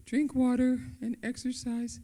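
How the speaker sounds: high sing-song quoting her own advice; lightly mocking her own simple advice. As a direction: toss it off in a lilting mock voice. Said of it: "embarrassed sometimes to have to say".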